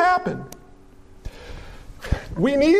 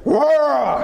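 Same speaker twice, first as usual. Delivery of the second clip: bear roar; 4 semitones higher than usual. Pretend. Bear roars in hunting story.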